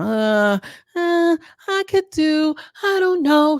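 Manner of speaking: high, slow dithering imitation; mock indecision. Mimics women unable to decide.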